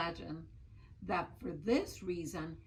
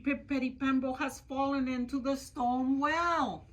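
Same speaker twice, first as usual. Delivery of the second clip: hurried urgent call; 5 semitones higher, 4 dB louder than usual. Acted. Chang urgently calling old man.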